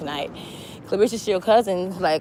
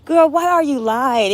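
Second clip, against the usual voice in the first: slow, higher, teasing disbelief; mock disbelief; 7 semitones higher, 4 dB louder than usual. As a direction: call out her lie with a drawn-out sneer. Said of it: "We like".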